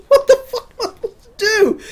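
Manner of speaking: high, fast, laughing through the words; laughing panic. Retold or imitated. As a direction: blurt it out in giggling panic. Panicked self, retold.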